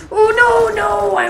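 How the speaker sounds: a high, loud cry of dismay as he dies; anguished shout. Real, not acted. Gamer dies mid-streak, cries out in loud dismay. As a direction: yell out in real frustration. Killed just before a big streak.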